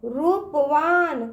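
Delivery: slow रूपवान, रू held long; drawn-out long ū. Shown as a demonstration. Teacher asking children to repeat, रूपवान with long ū. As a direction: stretch out रू in रूपवान. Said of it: "इसका उच्चारण ना खींच के करते हैं".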